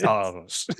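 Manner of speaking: "Charles" with a half-rolled R; mock-theatrical "Charles" with a half roll on the R; Ian McKellen's Magneto. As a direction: imitate an actor saying "Charles", R not quite rolled. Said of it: "He rolls that R, doesn't quite roll the R".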